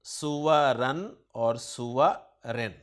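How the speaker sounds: سورن اور سورن drawn out very slowly with each ending sounded; case endings demo. Shown as a demonstration. Teacher showing the student the case endings, very slowly. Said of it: "مرفوع دو پیش کے ساتھ".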